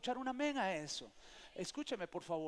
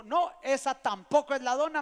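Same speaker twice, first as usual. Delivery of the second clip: loud, raised-pitch retold denial; firm contradiction; 5 semitones higher, 13 dB louder than usual. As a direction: contradict loudly, imitating the wife. Retold wife firmly contradicts him.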